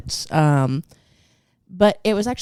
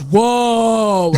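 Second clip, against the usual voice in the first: mock passerby whoa; 7 semitones higher, 5 dB louder than usual. Passing lady reacts to smashed car.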